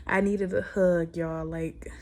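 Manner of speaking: real choked-back tears, trailing off softly; slow, then fading to a near whisper as tears come; holding back tears. Real, not acted. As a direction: fight the urge to cry. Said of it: "I feel it coming".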